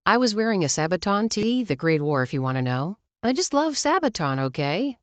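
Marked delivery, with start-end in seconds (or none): none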